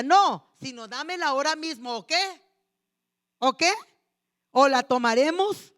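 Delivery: loud, bullying demand; angry threat. Retold or imitated. Eli's sons threatening the people in a loud angry voice. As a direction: shout them down like a thug.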